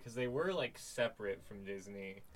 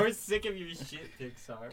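mock outrage; 5 semitones higher, 5 dB louder than usual. Mock protest chant.

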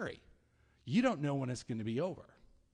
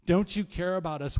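louder, higher, accusing question; louder accusing plea; 3 semitones higher, 8 dB louder than usual. Acted. Disciples to sleeping Jesus, louder accusing demand. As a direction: throw it at him, louder and higher.

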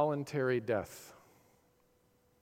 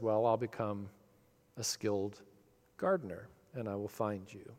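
gentle mother bunny; 5 semitones lower, 7 dB quieter than usual. Acted. Mother bunny answering her child.

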